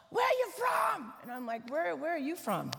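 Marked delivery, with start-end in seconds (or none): 0.1-1.1 high-pitched mock yell; yelling neighbor. Chicagoan neighbor yelling across the street at the newcomer, mock yell. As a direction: holler it like a gruff-mannered Chicagoan. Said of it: "he yelled and said".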